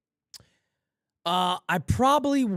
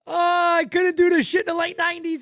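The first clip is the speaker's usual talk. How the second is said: ordinary talk, nothing marked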